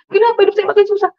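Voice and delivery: fast, high-pitched plaintive wail; retold crying lament. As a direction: re-enact a tearful complaint, quick and high. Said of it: "Saya menangis di belakang kosan". (keluhan tangis yang ditirukan)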